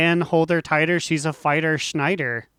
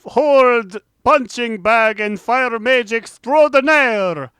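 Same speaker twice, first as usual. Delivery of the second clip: loud, slow spooky announcer; 8 semitones higher, 8 dB louder, slower than usual. Acted. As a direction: boom it out like a haunted host. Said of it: "because I'm spooky".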